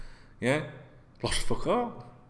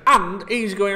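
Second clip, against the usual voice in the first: ordinary talk, nothing marked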